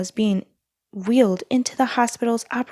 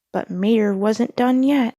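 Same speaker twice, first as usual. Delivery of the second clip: ordinary delivery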